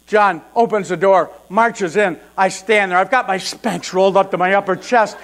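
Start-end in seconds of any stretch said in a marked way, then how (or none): none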